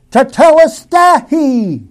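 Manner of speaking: high, loud, drawn-out shout in Greek; crying aloud. Acted. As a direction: cry it out slowly at full force. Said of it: "when he cried aloud".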